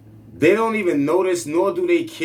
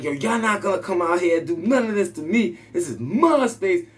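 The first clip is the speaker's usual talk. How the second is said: a mock yell at the ants, only slightly raised; retold yell at ants; 4 semitones higher than usual. Scolding the ants invading his room, mild mock yell. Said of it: "So I yell at them".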